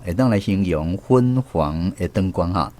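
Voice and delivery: ordinary talk, nothing marked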